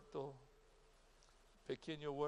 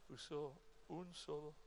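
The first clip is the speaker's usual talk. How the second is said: ordinary talk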